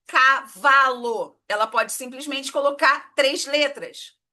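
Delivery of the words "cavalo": "cavalo" drawn out slowly, each syllable opened wide. Shown as a demonstration. Teacher showing the mouth opening three times, slowly spelled out. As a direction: stretch "cavalo" into ca-va-lo, slow. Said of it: "eu abri três vezes".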